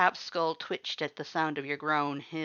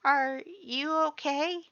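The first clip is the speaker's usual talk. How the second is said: higher, slow careful question; gentle, worried Butch; 11 semitones higher, slower than usual. A narrator's reading. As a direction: ask softly and carefully. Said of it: "Butch quietly knelt beside you".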